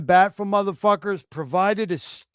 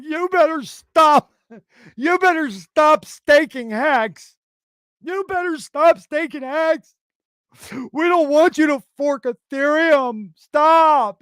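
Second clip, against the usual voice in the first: high, drawn-out whiny mockery; mock pleading detractors; 10 semitones higher, 4 dB louder, slower than usual. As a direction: mimic powerless critics begging, high and slow. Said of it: "They'd be like".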